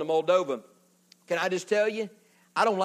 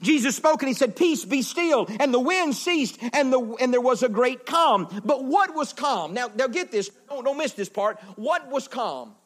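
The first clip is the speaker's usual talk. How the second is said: higher-pitched, driving sermon delivery; impassioned preaching; 6 semitones higher, 4 dB louder than usual. Real, not acted. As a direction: preach it with full, rolling energy. Preaches at full force.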